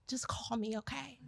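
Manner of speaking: sighing, then a breathy whisper. Retold or imitated. Dad quietly offering help to the substitute, whispered. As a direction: hush it like a worried dad. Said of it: "The dad is like".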